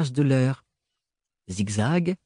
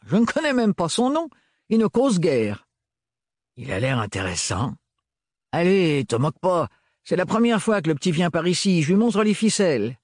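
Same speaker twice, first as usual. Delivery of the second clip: higher, bantering voice; Renard, jovial servant; 5 semitones higher than usual. A narrator's reading. Renard to Gaëlle, jovial higher banter. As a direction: play it as friendly banter.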